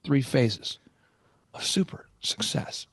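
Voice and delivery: slow theatrical delivery sinking into a whisper; hushed, dramatic, word by word; theatrical mentor. Retold or imitated. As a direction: imitate a grand guru, drawing out each word. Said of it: "by the way, very theatrical".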